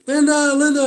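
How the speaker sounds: high, loud mock call. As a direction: call the name out loud like she did. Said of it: "she's saying".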